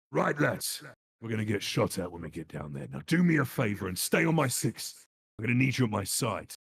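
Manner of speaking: grounded gruff commander voice; lightly voiced commander, played straight; understated gruff commander giving orders; natural gruff commander. Shown as a demonstration. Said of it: "if I was doing an English version, me, I would be like".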